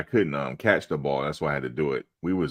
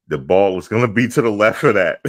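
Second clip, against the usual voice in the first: a raised, higher quarterback voice calling the play; loud huddle call; 6 semitones higher, 10 dB louder than usual. Retold or imitated. Quarterback telling his receiver where the ball goes, loudly. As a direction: bark the play across the huddle.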